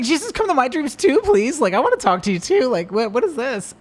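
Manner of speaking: high, excited mock plea; mock pleading. Dad retells begging to join son's dreams, high playful plea. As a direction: beg in playful disbelief.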